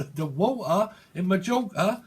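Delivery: loose Cockney with dropped t's; hammed-up London vernacular; improper Cockney. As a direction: play up a Cockney glottal stop. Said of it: "then someone doesn't".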